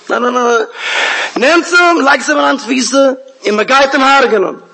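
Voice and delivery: high, commanding outburst; acted royal fury; enraged king. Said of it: "mit Kas gebrannt".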